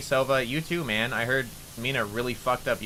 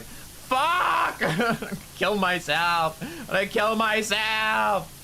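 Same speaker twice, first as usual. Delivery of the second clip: high, slow, drawn-out yell of embarrassment; mortified shriek; 12 semitones higher, much slower than usual. Real, not acted. Streamer recalling her posted voice clip, mortified wail. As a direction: howl it out, laughing at your own shame. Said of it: "mortified over embarrassing post".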